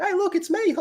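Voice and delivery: very high squeaky look-at-me voice; squeaky show-off. Retold or imitated. Mocking an actor who wipes off his makeup, very high squeak. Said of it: "to be like".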